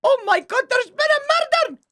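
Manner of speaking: high, hammed-up shocked announcement; mock horror. Player announcing a murder to friends, high mock horror. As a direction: cry out the reveal in overblown alarm. Spoofs the murder catchphrase excitedly.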